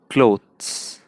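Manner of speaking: clothes with faint t; light t in clothes. Shown as a demonstration. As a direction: barely sound the t in क्लोथ्स. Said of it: "उसमें हल्का सा त की sound है".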